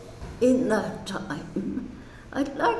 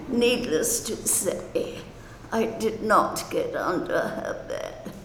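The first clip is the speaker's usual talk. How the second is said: ordinary talk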